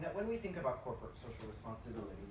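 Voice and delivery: normal talking voice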